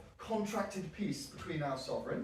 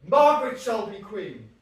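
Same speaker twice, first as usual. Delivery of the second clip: insistent declaration; 5 semitones higher, 11 dB louder than usual. Acted. Suffolk insists before the court.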